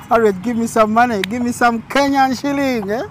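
loud teasing demand for cash; playful begging. Pretend. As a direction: beg for money, half joking.

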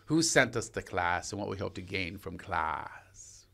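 a hint of a hushed earnest coach; imitating acting teacher. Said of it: "He goes".

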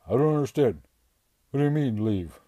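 a lovesick strongman who doesn't get it; confused strongman. A narrator's reading. Bradley confused and upset.

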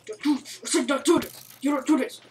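hammed-up plea to a gang of mobs; mock pleading.

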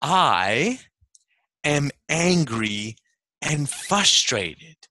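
slow, halting aha moment; drawn-out, amazed self-discovery; dawning realization. Retold or imitated. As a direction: let it dawn word by word. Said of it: "like an aha moment".

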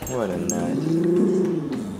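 very slow, last word stretched out and swooping up; drawn-out teasing. Real, not acted. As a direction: stretch NUT into a long rising and falling howl.

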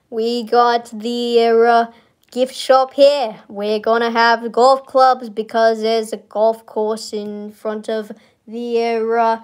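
slow, drawn-out mock Kennedy Boston accent; hammed-up JFK tour guide; a playful Boston Kennedy accent, slow; JFK impression. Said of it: "talk like J F K for the rest of this tour".

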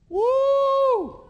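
high, loud, sustained "woo"; piercing, held exclamation; preacher's whoop. Real, not acted. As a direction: let out a long pulpit whoop. Preacher's exclamation after death verdict.

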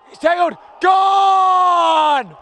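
thrilled commentator roar; excited call. Real, not acted. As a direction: bellow the big tackle. Commentator calls a big tackle.

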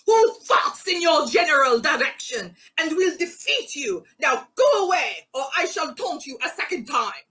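put-on high-pitched taunting French accent; outrageous French accent. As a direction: hurl a taunt in an over-the-top French accent. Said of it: "still with his outrageous French accent".

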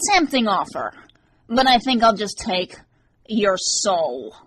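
sinister nasal stranger, toying with his prey; dark nasal voice. A narrator's reading. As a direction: start high, then sink onto the threat. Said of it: "a dark, nasally voice".

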